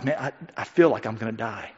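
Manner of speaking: low, soft, grave whisper of dread; hushed dread. Retold or imitated. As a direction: say it like a heavy confession. Jesus quoted, hushed and grave.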